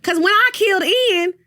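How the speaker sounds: high mock Portia voice, rambling. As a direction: imitate her high and loud, babbling. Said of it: "She was like".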